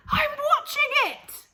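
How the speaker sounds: put-on gleeful old woman calling down; Grandma shouting.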